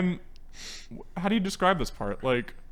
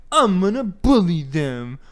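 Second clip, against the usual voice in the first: slow, louder mock villain; old-time villain; 3 semitones higher, 6 dB louder, slower than usual. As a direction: announce it like a cartoon bad guy. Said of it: "he also talks like a, like a nineteen thirties villain".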